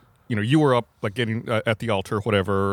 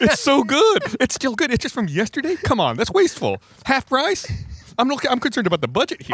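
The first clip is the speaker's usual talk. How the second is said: much higher, hammed-up pleading voice; mock thrifty plea; 13 semitones higher than usual. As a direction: argue for the cheap rolls in a silly voice. Mock defence of day-old rolls.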